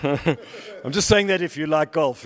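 laughing at his own golf joke. Real, not acted. Joking about golfers, laughing.

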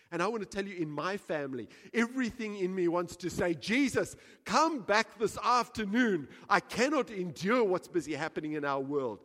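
loud, urgent sermon voice; impassioned pulpit plea; full-force preaching. Acted. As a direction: cry out for Jesus to return.

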